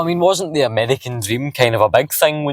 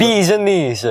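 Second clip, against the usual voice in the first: higher-pitched, sing-song fake Italian; mock Italian accent; 6 semitones higher, slower than usual. Passenger showing off an Italian accent, mock Italian. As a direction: add Italian vowels between the words.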